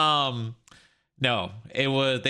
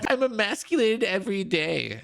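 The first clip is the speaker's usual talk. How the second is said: higher mock whine; sarcastic high-pitched parody; mocking imitation; 10 semitones higher than usual. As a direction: echo him in a high whiny voice. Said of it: "repeats the protester".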